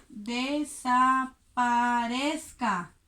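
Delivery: desaparezca drawn out very slowly; slow spelling-out. Shown as a demonstration. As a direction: stretch every syllable of desaparezca. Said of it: "Pero aquí". (desaparezca pronunciado muy despacio)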